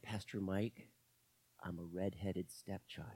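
put-on low, soft voice of a young man; quiet retold confession. Young man confiding in his pastor, quiet and low. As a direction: quote him very softly and low.

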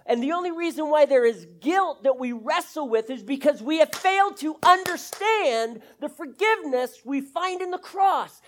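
loud, high, driving sermon voice; preaching at full force. Acted. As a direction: preach it out with growing fire.

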